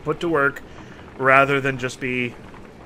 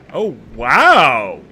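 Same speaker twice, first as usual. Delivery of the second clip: louder, stunned exclamation; real surprise; 3 semitones lower, 9 dB louder than usual. Real, not acted. Hearing the proud chief beg, a loud stunned outburst. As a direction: blurt it out, caught off guard.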